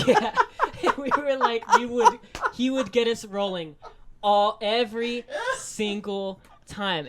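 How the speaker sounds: words broken by laughter, slowed; giggling through the memory; laughing. Real, not acted.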